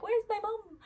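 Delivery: high, soft little dog voice asking for mum; pretend dog asking. To the viewers, voicing the dog's worry in a high little voice. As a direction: voice the dog as if it misses her. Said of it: "he's not like".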